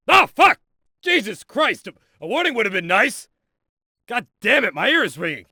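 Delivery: high-pitched, shocked outburst; startled cursing. Acted. Instructor's ears ring after explosion, startled cursing. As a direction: curse as if the blast caught you off guard.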